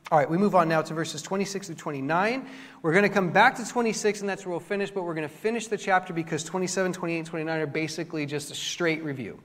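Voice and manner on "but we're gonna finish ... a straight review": ordinary talk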